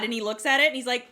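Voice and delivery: normal talking voice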